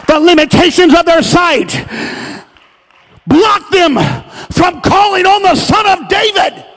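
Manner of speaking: big rising preacher's cadence, pressing each point; full-force preaching. Acted. Preacher urging the congregation to see their brothers, full-force preaching. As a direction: drive it home like a pulpit climax.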